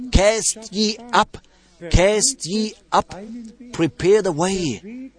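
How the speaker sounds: high, slow preacher's proclamation; scripture proclamation. A narrator's reading. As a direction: proclaim the command slowly, pitched high. Proclaiming a quoted divine command.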